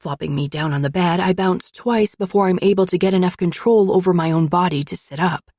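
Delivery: plain reading voice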